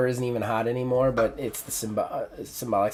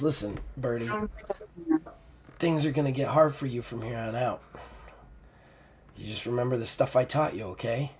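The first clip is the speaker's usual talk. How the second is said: put-on soft, fading old woman's voice; dying mentor, tender; 6 dB quieter than usual. Dying mentor thanks Ruby, soft and tender. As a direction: speak gently through the weakness.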